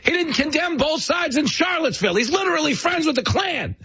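very high, shouted mock accusations; mock Democrat hustler. Democrats shouting accusations at Trump, very high shrill hustler voice. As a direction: shriek it like a street hustler. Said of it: "walking down the street going".

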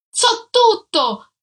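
high, loud, slow furious yell; shouted. A narrator's reading. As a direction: hurl it at her.

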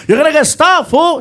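high, loud nagging backseat friend; Felipe yelling. Retold or imitated. Friend warning reckless driver, high loud yelling. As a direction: yell warnings as the worried buddy. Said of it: "my friend Felipe is yelling at me".

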